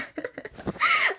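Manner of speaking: high squeal of a laugh; a sudden high giggle; squeaky laugh. Real, not acted. Embarrassed at her own words, a high squealing laugh.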